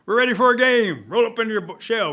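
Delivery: loud, high taunting shout; bullying call. Acted. Animals ordering turtle into his shell, loud and bossy. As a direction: holler at the turtle to get in position.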